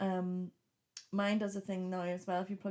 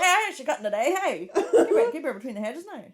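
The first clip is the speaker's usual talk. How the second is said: hammed-up, high, loud Ballymena accent; 6 semitones higher, 6 dB louder than usual. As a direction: put on Ballymena talk through laughter. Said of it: "can't do a Ballymena accent".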